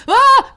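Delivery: short high, loud scream; mock scream. Imitating Earl's instant panicked scream in the hole. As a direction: yelp like you just fell in a hole. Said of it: "he instantly screams".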